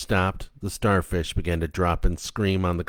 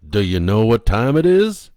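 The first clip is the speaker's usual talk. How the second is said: louder cheery host question; mild kids' host; 2 semitones higher, 8 dB louder than usual. Acted. Captain Kangaroo asking the puppet the time, loud. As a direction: ask it like a children's TV host.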